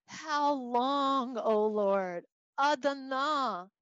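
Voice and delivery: slow, drawn-out, belted lament; crying out to God. Acted. Teacher as the prophet calling on the Lord, crying out. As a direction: stretch each word into a plea. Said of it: "this is him asking".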